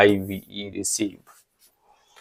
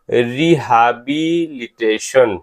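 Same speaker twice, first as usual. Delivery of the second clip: Rehabilitation slowly broken into syllables; much slower than usual. Shown as a demonstration. Teacher sounding out a word for spelling, slow syllables in rehabilitation.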